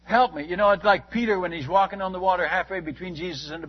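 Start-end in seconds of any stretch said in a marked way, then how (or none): 0.0-0.5 mild mock cry for help; re-enacted desperate plea; retold scream. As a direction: cry out to God, raised but controlled. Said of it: "I screamed".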